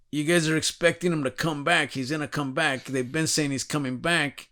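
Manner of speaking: usual speaking voice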